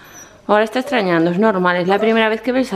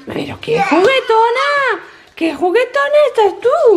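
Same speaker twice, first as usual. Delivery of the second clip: much higher, slow sing-song cooing; puppy talk; 11 semitones higher than usual. Real, not acted. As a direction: coo at the playful puppy. Coos at the playful puppy.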